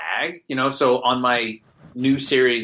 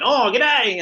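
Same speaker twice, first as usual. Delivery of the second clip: high, louder mock Australian greeting; Australian accent; 12 semitones higher, 5 dB louder than usual. Quoting his scratch track in Australian accent. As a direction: toss off a cartoon Aussie g'day. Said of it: "my scratch track ... of me going".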